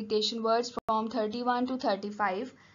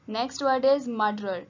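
usual speaking voice

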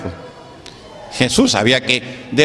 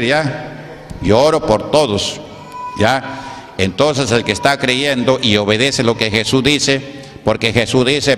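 usual speaking voice

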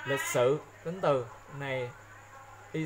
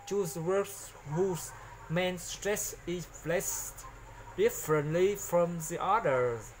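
slow careful reading; slower than usual. Shown as a demonstration. Teacher reads English instructions.

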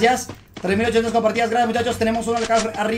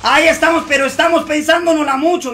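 raised, louder hyped commentary; excited; 6 semitones higher, 6 dB louder than usual. Real, not acted. Gamer still hyped after landing the hit, talking loud. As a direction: ride the adrenaline.

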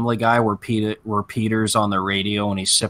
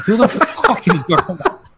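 laughing disbelief; 9 semitones higher, 4 dB louder than usual. Retold or imitated. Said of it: "I was just like".

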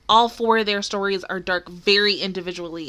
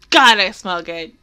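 usual speaking voice

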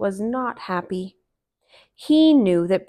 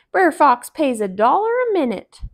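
Brer Rabbit; 6 semitones higher, 6 dB louder than usual. Acted. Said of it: "said Brer Rabbit".

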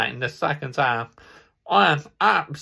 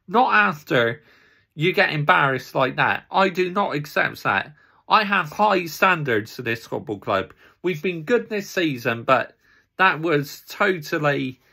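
normal talking voice

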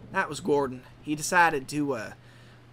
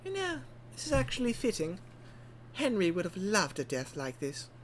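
soft, higher musing character voice; wistful mourner; 4 semitones higher, 8 dB quieter than usual. Acted. Engine musing on Henry's death in the forest, soft wistful voice. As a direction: muse softly over a death.

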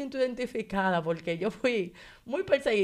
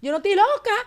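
high, loud insistence; loud, high-pitched desperate denial; frantic retold protest; 9 semitones higher, 6 dB louder than usual. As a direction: cry out in protest, reenacting the moment.

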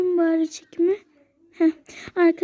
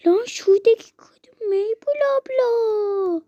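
put-on slow, higher kid's voice pointing someone out; a kid spotting a celebrity, drawn out; little boy gasping at a famous vlogger; excited kid voice; 5 semitones higher, slower than usual. Child spots the vlogger, acted.

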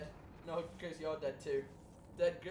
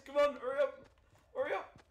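urgent; 12 semitones higher, 5 dB louder than usual. Real, not acted. Urging teammate to run.

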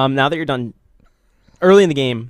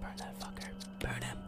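gleeful menacing whisper; eager whisper; 14 dB quieter than usual. Pretend. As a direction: hiss it like a gleeful villain. Urging partner to burn zombie.

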